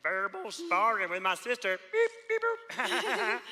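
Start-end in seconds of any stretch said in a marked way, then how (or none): 1.9-3.4 high robot beeps; nervous goofy beep-boop; silly beeping. Acted. Nervous silly noise to the marshal.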